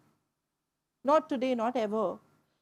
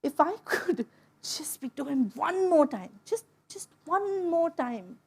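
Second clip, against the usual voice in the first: near tears, softer; real crying catching the words; voice breaking; 3 dB quieter than usual. Real, not acted. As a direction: grief for her late father cracking through. Speaks of lost father.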